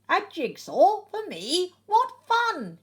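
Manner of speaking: high, loud, delighted egg voice; Humpty Dumpty's delight. A narrator's reading. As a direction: gush like a thrilled Humpty Dumpty. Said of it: "he thinks".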